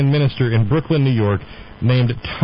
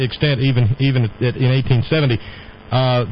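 ordinary talk, nothing marked

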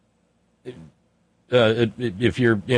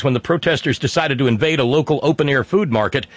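ordinary talk, nothing marked

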